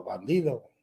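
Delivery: imitated echo. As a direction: send the word back faintly, like a cave. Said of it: "y el eco". (eco imitado, más grave y flojo)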